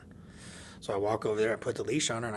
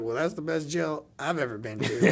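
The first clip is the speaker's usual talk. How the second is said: louder, laughing through the line; laughing retort; 5 semitones higher, 5 dB louder than usual. Retold or imitated. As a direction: crack yourself up while answering. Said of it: "And I was like".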